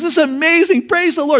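high, excited cry of joy; delighted yelp, pitched high; thrilled rider. Retold or imitated. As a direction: cheer it out as the horse takes off. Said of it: "He's so excited".

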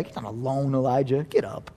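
God comforting Elijah; tender God. Retold or imitated. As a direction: lift him up gently. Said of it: "And God says".

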